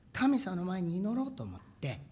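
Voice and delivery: normal talking voice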